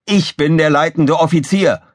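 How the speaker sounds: high, indignant bark; Gizmo barking. A narrator's reading. Engineer claims command over rival, barking. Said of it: "blaffte Gizmo".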